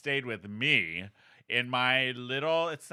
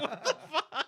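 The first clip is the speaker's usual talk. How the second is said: high, laughing what the; a laughing gasp of disbelief; shocked disbelief; 13 semitones higher than usual. Retold or imitated. Aunt reacting to nephew, high laughing shock. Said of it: "I was like".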